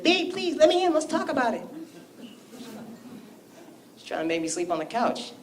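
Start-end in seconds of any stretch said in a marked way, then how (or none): 0.0-1.6 high, soft mock begging; wimpy pleading. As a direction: plead like a pushover at the door. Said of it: "I'm not gonna knock like a bitch".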